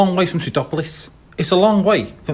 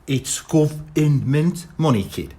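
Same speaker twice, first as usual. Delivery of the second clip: slow, patient explaining; 3 semitones lower, much slower than usual. Acted. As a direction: spell it out gently. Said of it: "said Morris patiently".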